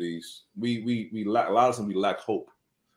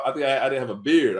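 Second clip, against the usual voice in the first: ordinary delivery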